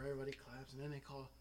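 normal talking voice

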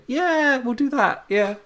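very high, squeaky mock-England voice; put-on high voice of an eager follower; meek British yes-man. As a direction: agree timidly from the background in a comic falsetto. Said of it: "doing that voice for England".

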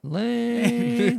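high, held-out jeer; mocking heckle. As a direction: draw it out like a heckler in the crowd. Heckles friend's flopped story.

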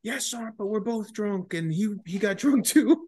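squeaky friend covering for him; high mock buddy voice. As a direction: play the nervous buddy in a high voice.

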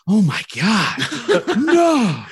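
high, loud, dragged-out horrified wail breaking into a laugh; imitating Gus's hysterical overreaction at the bank manager's story; over-the-top Gus. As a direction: ham up a shocked gasp of disbelief. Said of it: "so over the top".

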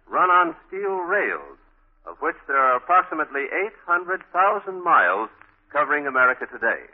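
ordinary talk

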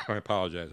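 cough, then apologize; coughing fit. Real, not acted. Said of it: "I'm, uh, coughing here".

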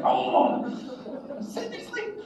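groan, then a tiny high squeak; mock whine, shooting up high and soft; dismayed disbelief. Student let into class anyway, dismayed.

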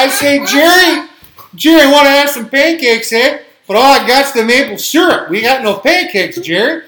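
loud, high hammed-up Canadian caller; mock Canadian accent. Tom hollers to Jerry about pancakes on a prank call, hammed-up Canadian accent. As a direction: belt it out as an excited Canadian cartoon caller. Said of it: "Tom and Jerry call Canada".

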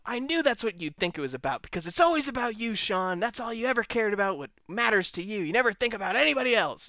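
Owen retort. Retold or imitated. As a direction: throw his selfishness back at him. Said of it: "he's like".

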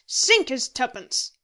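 slow, loud curse; damn it slowly and forcefully; heavy, contemptuous oath; cursing. A narrator's reading. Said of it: "muttered Moody with a curse".

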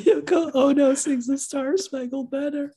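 high, laughing disbelief. Real, not acted. Cracking up telling cohost about a video, laughing. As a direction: barely get it out through laughter.

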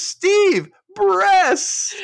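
loud, very high shout to a pal; hammed-up high yell; mock excited call. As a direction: call out to the group, overexcited. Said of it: "turns to the group and says".

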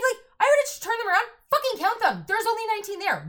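high, fast mock outrage, ranting about the cheating vendor to co-host; indignant rant.

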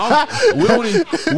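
high laughing protest. Real, not acted. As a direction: crack up mid-sentence.

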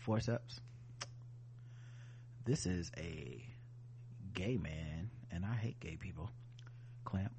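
flat, quiet operating-room voice; deadpan surgeon calmly asking for tools. Pretend. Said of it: "in the surgeon voice where he was like".

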